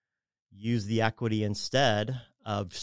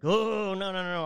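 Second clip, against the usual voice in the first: balking client; 9 semitones higher than usual. Retold or imitated. Client refusing to put card debt on the house, high mock alarm. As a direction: recoil in high-pitched protest, imitating a client.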